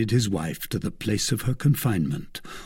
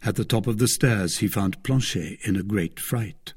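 usual speaking voice

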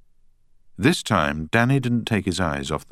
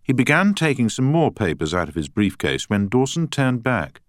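plain reading voice

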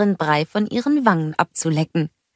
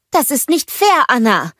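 higher, louder protest; complaining; 8 semitones higher, 5 dB louder than usual. A narrator's reading. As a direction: call out an indignant objection. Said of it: "beschwerte sich Elsa". (laut und empört beschwert)

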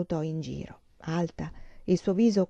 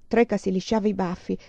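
usual speaking voice

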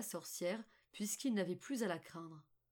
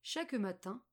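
usual speaking voice

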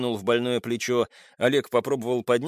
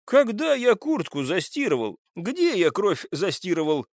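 high, indignant questioning; 7 semitones higher than usual. Acted. Suspect disputes washing his jacket, indignant. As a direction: fire the questions back, pitch raised. Accused indignantly rejects the charge.

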